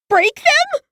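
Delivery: put-on high, disbelieving girl with a breaking voice; voice cracking. A narrator's reading. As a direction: let the voice crack in fear. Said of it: "Her voice cracks".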